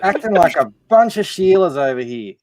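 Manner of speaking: mock Australian accent. Friend teasing the Americans on stream in a mock Australian accent. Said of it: "you blokes are destroying our language".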